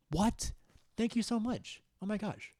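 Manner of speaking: high, loud gasping thanks; excited high thank you; surprised delight. Real, not acted. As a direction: squeal with surprise at the rose. Surprised by a gift rose.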